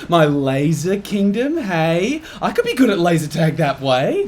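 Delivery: ordinary talk, nothing marked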